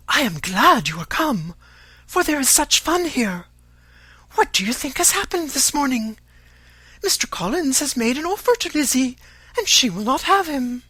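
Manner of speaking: put-on high, loud, eager girl; excited Lydia. A narrator's reading. Girl telling visitor the morning's news, excited. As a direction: spill the gossip in high-pitched glee. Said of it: "cried in a half whisper".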